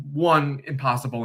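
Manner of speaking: ordinary talk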